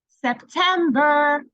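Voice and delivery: slow, loud, high, syllable by syllable; drawn-out syllables. Shown as a demonstration. Teacher to kids, counting syllables slowly and loudly. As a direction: stretch every syllable out. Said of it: "teacher demos syllables".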